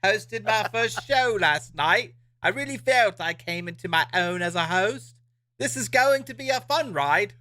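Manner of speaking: high, hammed-up British accent. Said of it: "read that in a British accent".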